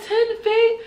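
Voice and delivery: high, shocked Ten feet?; shocked question. Retold or imitated. Teller reacting to the ten-foot pool, high and shocked. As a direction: squeak it out in disbelief. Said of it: "I said".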